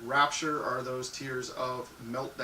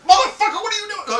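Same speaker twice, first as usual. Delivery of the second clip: much higher, much louder mock outrage; furious yell; 13 dB louder than usual. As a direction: scream it like you've been hit too hard. Partner yells after hard slap.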